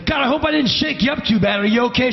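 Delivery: high, hyped-up apology; over-eager concern. Retold or imitated. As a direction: still pumped, check on them. Hyped auditioner checks on shaken assistant.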